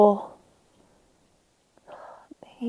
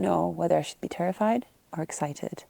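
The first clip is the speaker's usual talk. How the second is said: whisper; 2 semitones lower, 8 dB quieter than usual. Real, not acted. Near wolf territory at night.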